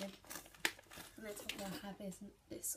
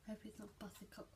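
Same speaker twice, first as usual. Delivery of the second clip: whispering; 9 dB quieter than usual. Real, not acted. Whispers while tasting.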